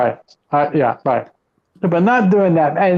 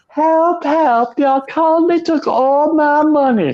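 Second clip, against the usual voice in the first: Zorro movie villager; 6 semitones higher, much slower than usual. Retold or imitated. Quoting the Zorro movie again for Pedro, high mock plea. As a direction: replay the townsman's cry, then the hero's promise.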